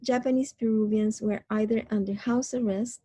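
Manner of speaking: ordinary talk, nothing marked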